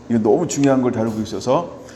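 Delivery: normal talking voice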